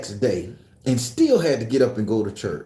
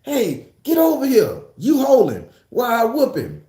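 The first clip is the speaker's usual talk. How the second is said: mama yelling; 8 semitones higher than usual. Retold or imitated. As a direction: bark orders like an angry mother. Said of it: "she called my brother".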